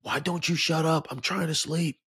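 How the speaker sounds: imitating a grumpy neighbour yelling; angry yell. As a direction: snap at the bird as if just woken. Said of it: "yells at the bird".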